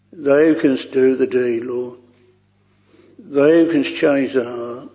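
ordinary talk, nothing marked

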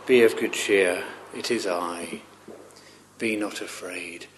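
hushed comfort to the fearful; reassuring Jesus. Acted. Jesus speaking to frightened disciples.

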